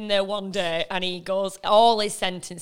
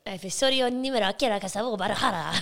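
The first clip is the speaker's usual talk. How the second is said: rattled-off mock Spanish; Spanish accent; faster than usual. Host failing to copy the phrase, badly faked Spanish accent. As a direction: bluff through it in a fake Spanish accent. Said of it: "I can't even copy it".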